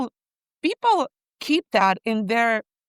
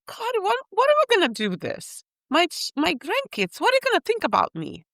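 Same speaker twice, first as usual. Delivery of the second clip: panicked plea; 8 semitones higher than usual. Retold or imitated. Retelling her panicked plea to God.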